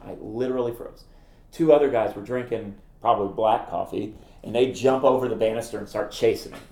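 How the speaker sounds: ordinary talk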